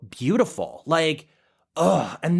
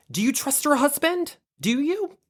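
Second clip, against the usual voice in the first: much higher, pointed mock question; imitating Lisa Rinna; 11 semitones higher than usual. Lisa Rinna pressing Dorit about her husband, high teasing. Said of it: "she followed up with a".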